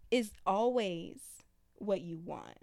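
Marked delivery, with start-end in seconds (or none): none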